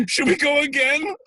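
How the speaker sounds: very high, loud squeaky laugh-cry; giggling through tears. Retold or imitated. Actor asking a crying room to go again, giggling. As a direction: squeal the question between bursts of laughter. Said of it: "Like that".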